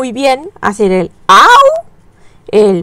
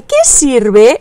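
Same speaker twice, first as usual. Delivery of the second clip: usual speaking voice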